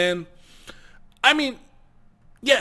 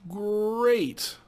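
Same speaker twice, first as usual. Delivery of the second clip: normal talking voice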